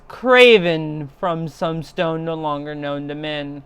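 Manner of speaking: ordinary delivery, nothing marked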